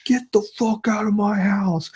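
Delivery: imitating the drunk neighbor yelling from his house; retold yell. Man in underwear yelling at his house, slow mock yell. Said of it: "I just hear him yelling".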